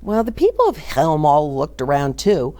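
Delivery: usual speaking voice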